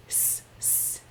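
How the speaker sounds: whispered hiss, hiss; soft hissing sound; snake hiss. Acted. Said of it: "they all went hiss".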